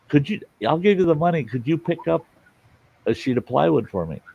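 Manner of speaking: imitated neighbor, high-pitched and excited; eager request. Neighbor asking him to pick up plywood, eager. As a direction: mimic an eager plea, voice well up.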